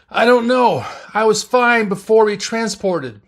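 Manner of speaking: high, loud voice of someone hurting; injured man protesting in pain; pained, distressed reply. A narrator's reading. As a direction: answer in distress, pitched well up. Injured Sulu answering in pain.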